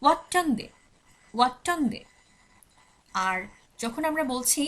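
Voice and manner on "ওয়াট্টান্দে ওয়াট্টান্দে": ওয়াট্টান্দে said higher as "wat-tan-de", the doubled t held; Swedish pronunciation demo. Shown as a demonstration. Teacher shows students the Swedish eighth, careful pronunciation. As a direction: model ওয়াট্টান্দে twice, falling. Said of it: "তখন বলা হচ্ছে".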